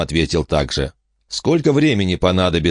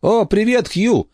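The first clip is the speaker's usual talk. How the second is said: high, bright hello; happy to hear him; cheerful greeting; slower than usual. Acted. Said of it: "весело сказала Эмма".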